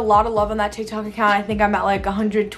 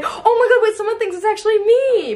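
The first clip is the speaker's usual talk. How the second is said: much higher and louder, shocked; delighted shock; 12 semitones higher, 4 dB louder than usual. Real, not acted. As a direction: gasp out the discovery. Someone thinks it's actually her.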